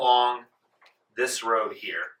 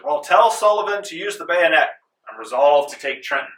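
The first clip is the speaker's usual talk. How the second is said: lightly acted commander, raised voice; louder, higher, resolute order; Washington; 5 semitones higher, 8 dB louder than usual. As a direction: declare it like a general giving orders. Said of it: "you hear Washington muttering and says".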